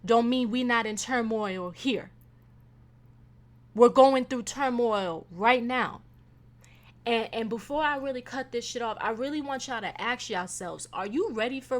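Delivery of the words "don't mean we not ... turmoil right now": slow, louder emphasis; emphatic. Real, not acted. Warning her listeners about turmoil, emphatic. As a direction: lean into each word.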